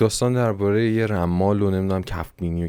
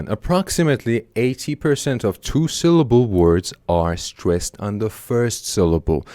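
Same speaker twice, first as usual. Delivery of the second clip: very slow, careful textbook reading; slow reading aloud; much slower than usual. Shown as a demonstration. Teacher reads rule slowly to students. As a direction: read the rule slowly for learners. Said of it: "چی میگه؟".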